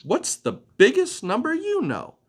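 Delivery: high, eager little butterfly; bright butterfly voice. Acted. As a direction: greet her like a curious small butterfly. Butterfly asks.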